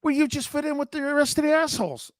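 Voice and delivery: imitating a teasing wife, quick and high. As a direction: rattle it off as his wife needling him. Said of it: "She goes like this".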